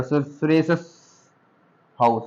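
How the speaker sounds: सुरेश हाउस with the s running into the h, a hissing join; hissing s sound. Shown as a demonstration. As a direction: let the s of सुरेश hiss into हाउस. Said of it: "अब इसको बोल के दिखाओ जरा".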